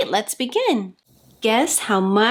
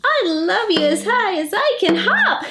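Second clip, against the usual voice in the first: giggling, raised high; laughing little hare; 10 semitones higher than usual. Acted. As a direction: bounce it out with a laugh.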